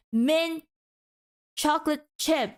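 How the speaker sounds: "Mint ... chocolate chip" in separate beats, pause after "Mint"; counted out. Shown as a demonstration. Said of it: "That's like three syllables".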